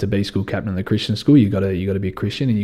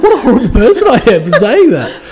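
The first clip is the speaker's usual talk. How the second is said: high, louder, laughing mock apology; imitating her laughing apology; 14 semitones higher, 8 dB louder than usual. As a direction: play her giggling sorry in a squeaky voice. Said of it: "She's like".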